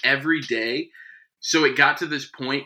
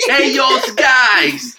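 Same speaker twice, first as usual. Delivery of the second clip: impersonating friend's mother; 9 semitones higher, 5 dB louder, much slower than usual. Acted. Mocking a friend's mother, hammed-up impression. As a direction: draw it out slowly as a teasing impersonation. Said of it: "that was an impersonation of Isaac's mother".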